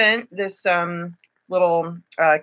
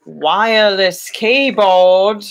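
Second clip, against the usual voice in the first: slow British drawl on "wireless keyboard", louder at the end; British accent; 5 dB louder, much slower than usual. Pretend. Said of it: "the British is gonna come and go".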